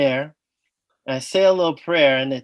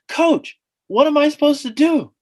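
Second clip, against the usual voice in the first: panicked plea; 10 semitones higher, 4 dB louder than usual. Retold or imitated. Begging the coach in panic.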